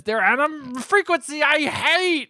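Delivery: high, slow, hammed-up kid line; overacting kid. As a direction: ham it up like a dramatic child. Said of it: "one of those kids who's overacting".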